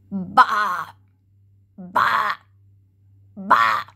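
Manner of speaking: loud low bleating goat. Retold or imitated. Showing children what the goat says, loud bleat. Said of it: "A goat says".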